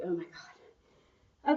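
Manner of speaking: slow, quiet, worn-out "oh my God"; exhausted groan. Retold or imitated. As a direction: drag it out, spent. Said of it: "you're like".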